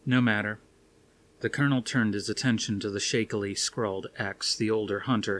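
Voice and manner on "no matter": ordinary delivery, nothing marked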